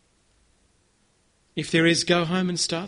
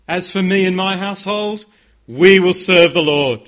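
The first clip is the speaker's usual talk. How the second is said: passionate vow; 10 dB louder than usual. Acted. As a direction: declare it with full force. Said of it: "I wanna hear some passion".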